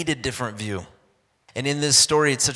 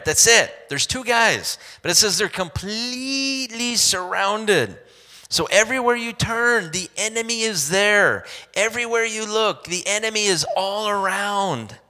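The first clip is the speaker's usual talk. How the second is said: preaching at full force; 8 semitones higher than usual. Acted. Preacher building to the congregation about the surrounding enemy. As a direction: drive the point home from the pulpit.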